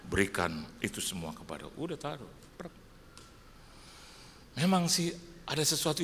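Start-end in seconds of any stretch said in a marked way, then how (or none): 0.1-1.7 low, restrained divine command; voice of God, lightly voiced. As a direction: give a hint of God speaking, low. Retelling God's quiet command. (sedikit menirukan suara Tuhan yang rendah)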